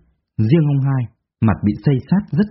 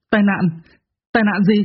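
higher, stunned questions; horrified disbelief; 7 semitones higher than usual. Acted. Quý hearing of his parents' accident, horrified disbelief. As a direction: gasp out the words in shock. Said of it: "Quý kinh hoàng đứng bật dậy".

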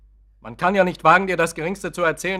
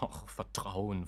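bitter murmur; 7 semitones lower, 14 dB quieter, much slower than usual. Acted. Bitter echo of her word.

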